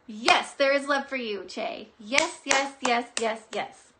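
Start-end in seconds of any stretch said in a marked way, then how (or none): 2.0-3.9 loud rapid yeses; eager yeses. Real, not acted. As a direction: nod along eagerly. Confirming the vision excitedly.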